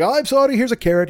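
high squeaky apology; suddenly high, mock-polite sorry; Doolittle apologizing. Doolittle apologizing to the rabbit, high mock sorry. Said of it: "And Doolittle's like".

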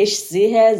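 ordinary talk, nothing marked

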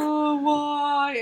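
high, hammed-up lament; mock upset. Imitating the hero upset at being insulted, mock despair. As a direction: wail it lightly, in jest. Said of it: "he gets very upset".

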